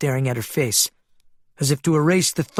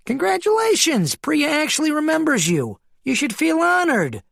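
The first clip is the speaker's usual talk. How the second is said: much higher, smug and teasing; high-pitched mocking voice; 13 semitones higher than usual. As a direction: needle him in a high voice. Keen mocking Maglev.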